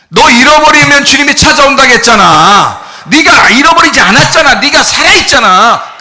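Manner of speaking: loud, high, drawn-out rebuke from the pulpit; impassioned preaching. Acted. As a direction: scold the congregation at full force. Preaches at full force.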